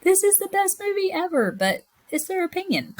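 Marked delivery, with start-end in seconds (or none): 0.0-1.5 mock outraged defence of the movie; mock indignant gush. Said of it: "And I was like".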